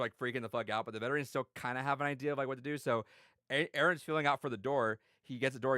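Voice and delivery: ordinary talk, nothing marked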